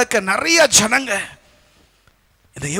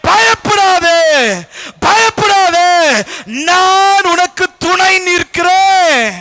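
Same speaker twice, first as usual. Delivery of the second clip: high, loud, drawn-out preaching cry; loud proclamation; 8 semitones higher, 6 dB louder, much slower than usual. Acted. Preacher voicing God's promise to the congregation, loudly proclaiming. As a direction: proclaim the promise with full force.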